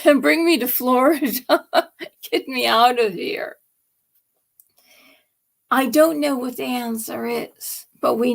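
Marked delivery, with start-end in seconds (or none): 0.1-3.6 laughing through the words; amused, chuckling plea. Real, not acted. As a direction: joke it with a laugh breaking in. Joking plea, laughing along.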